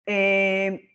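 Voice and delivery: normal talking voice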